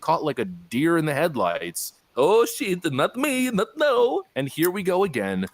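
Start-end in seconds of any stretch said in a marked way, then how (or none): 2.2-4.2 high, panicked squeak of a cornered spokeswoman; hammed-up "oh no, not me" panic; mock panic. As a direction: play the deer in the headlights, pitch jumping up high. Mock panic voice of press secretary.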